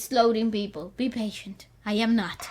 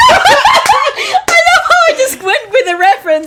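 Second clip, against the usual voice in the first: laughing; 6 semitones higher, 11 dB louder than usual. Real, not acted. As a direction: laugh through it at yourself. Laughing at herself.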